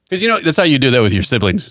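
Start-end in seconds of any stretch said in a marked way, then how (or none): none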